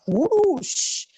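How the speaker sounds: ordinary delivery, nothing marked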